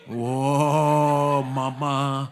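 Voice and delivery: slow, loud mock-grand announcement; mock proclamation. Preacher mocking the ruling mother, slow grand proclamation. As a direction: hail mom like a mock ruler.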